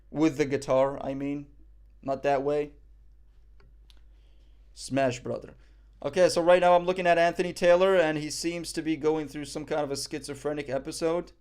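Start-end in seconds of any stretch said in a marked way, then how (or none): none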